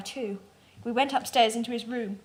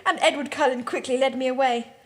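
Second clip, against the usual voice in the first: plain reading voice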